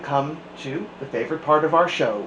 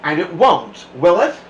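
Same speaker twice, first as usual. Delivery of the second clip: louder, pointed warning question; menacing threat; 3 semitones higher, 8 dB louder than usual. A narrator's reading. Strict teacher warning the class to behave. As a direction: lean in and threaten.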